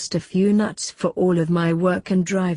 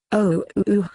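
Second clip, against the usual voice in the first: a hammed-up fake Australian accent, crying out; mock Australian-accented cries of pleasure; grandma yelling out in a bad fake Australian accent. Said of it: "she yells again".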